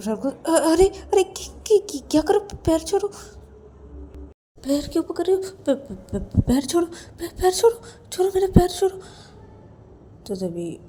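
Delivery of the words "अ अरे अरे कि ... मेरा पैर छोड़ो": high, stammering alarm; flustered high-pitched protest; startled protest. Acted. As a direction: beg someone to let go. Ravi startled as feet are grabbed.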